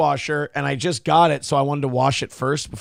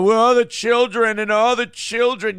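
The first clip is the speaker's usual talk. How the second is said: higher mocking sing-song; 5 semitones higher, 4 dB louder than usual. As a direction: parody of a preachy official. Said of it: "public school bullshit about how".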